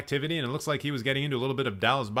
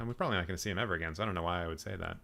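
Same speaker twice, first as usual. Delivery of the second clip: rushed, much softer and lower; muttered aside; 8 semitones lower, 9 dB quieter, much faster than usual. Real, not acted. Vlogger mutters a quick self-correcting aside, much softer. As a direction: mumble it to yourself quickly.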